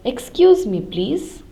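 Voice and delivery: usual speaking voice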